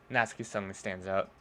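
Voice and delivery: ordinary talk, nothing marked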